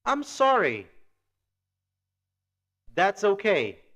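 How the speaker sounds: loud, clearly enunciated English model; loud teacher demonstration with long pauses; language-teacher model. Shown as a demonstration. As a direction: project each phrase for students to repeat. Model phrases for students to repeat.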